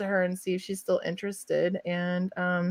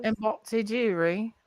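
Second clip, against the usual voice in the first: mock British; 4 dB quieter than usual. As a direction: mimic the line with a British lilt. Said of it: "have to be in British accent".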